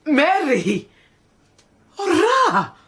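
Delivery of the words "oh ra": dismayed wail of oh. Acted. Dismayed cry.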